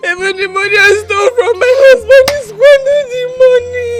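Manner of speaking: choked, tearful pleading; sobbing confession. Acted. Sobbing to her elder sister about the man who deceived her. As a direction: weep out the betrayal and beg forgiveness.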